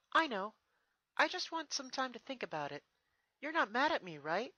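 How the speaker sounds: hoping she isn't in trouble; nervous young princess, pitched high. Acted. Twilight worried she upset Celestia.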